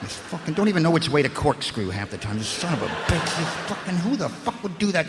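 usual speaking voice

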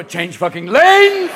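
slow, loud yell; shouting at driver. Retold or imitated. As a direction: yell at the car in the next lane. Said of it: "you go".

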